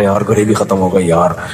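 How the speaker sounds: low, groggy moan about the nihari running out; dazed overfed diner. Retold or imitated. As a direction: sound half-passed-out after overeating. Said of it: "थोड़ी बेहोश पड़े हुए हैं". (निहारी खाकर बेहोश सा, धीमी आवाज़ में)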